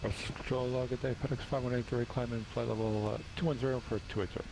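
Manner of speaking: crackly cockpit radio; radio transmission from the cockpit; over a pilot headset. Real, not acted.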